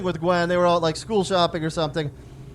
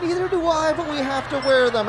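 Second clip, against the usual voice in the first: high reply; 7 semitones higher than usual. Retold or imitated. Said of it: "I'm like".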